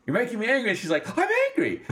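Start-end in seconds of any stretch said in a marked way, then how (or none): 0.1-0.7 high-pitched imitated indignation; hammed-up squeaky outrage; mock anger. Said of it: "that part where she's like". 1.2-1.8 very high, squeaky mock discovery; hammed-up squeal of realization; amazed by anger. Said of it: "she's also just experienced anger".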